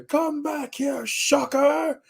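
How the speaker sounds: high, slow strained call; hammed-up chase call. Spider-Man calling after fleeing Shocker, hammed-up. Performs the wished-for chase line.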